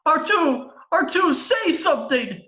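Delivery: high, alarmed cry; desperate plea. Acted. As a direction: beg a fallen friend to answer. Pleading with fallen droid.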